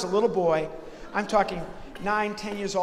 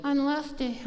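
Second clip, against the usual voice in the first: a higher, squeaky hand-puppet voice; higher puppet voice introducing itself; Lefty the puppet; 7 semitones higher than usual. Acted. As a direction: chirp it up high as the second puppet. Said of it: "Who are you?".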